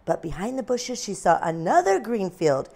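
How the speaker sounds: usual speaking voice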